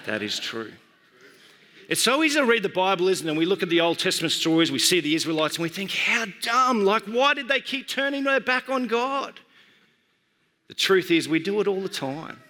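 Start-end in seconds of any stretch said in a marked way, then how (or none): 0.0-0.7 very low, soft, steady voice; Holy Spirit. Retold or imitated. Spirit answering the preacher inwardly, low and hushed. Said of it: "the Holy Spirit said to me". 5.9-9.4 mock disbelief, rising higher; incredulous. Bible readers judging the Israelites, incredulous.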